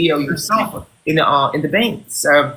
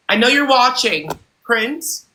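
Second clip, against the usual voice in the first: high-pitched call to a hidden listener; 6 semitones higher, slower than usual. Real, not acted. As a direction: coax them out with a high, rising name. Calling to a viewer by name.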